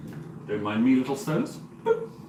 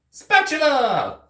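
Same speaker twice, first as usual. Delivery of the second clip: "Spatula!" yelled much higher, slow and drawn out; shouted spatula; 13 semitones higher, 5 dB louder, much slower than usual. Shown as a demonstration. Cook calling out for a spatula, slow drawn-out shout. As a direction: belt out "Spatula!" long and high. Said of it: "I need a spatula".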